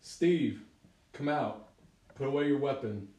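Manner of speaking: loud, firm shouted order; yelling out. Acted. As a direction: call out to a hidden friend. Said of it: "And I yell out".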